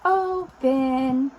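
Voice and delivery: "Open" stretched slowly into two loud syllables; drawn-out demonstration. Shown as a demonstration.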